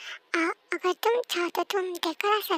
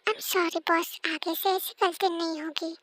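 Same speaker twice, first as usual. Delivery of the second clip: usual speaking voice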